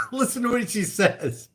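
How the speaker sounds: ordinary talk, nothing marked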